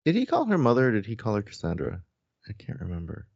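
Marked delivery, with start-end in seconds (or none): none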